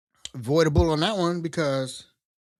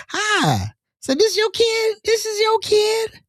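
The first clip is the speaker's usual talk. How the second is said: much higher, sweet fake-polite voice; butter up the mom; high, syrupy phone politeness; sugary polite voice; 14 semitones higher than usual. Charming the mother on phone.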